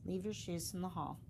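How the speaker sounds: usual speaking voice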